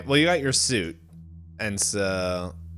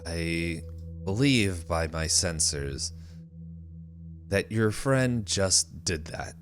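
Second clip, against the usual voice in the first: slow, lower computer voice; computer character Duat; 5 semitones lower, 6 dB quieter, slower than usual. Acted. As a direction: answer as a measured, deliberate machine. Game master voices computer Duat.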